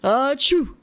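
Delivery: loud booming fake achoo; hammed-up dad sneeze, full voice. Shown as a demonstration. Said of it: "Is that a dad sneeze".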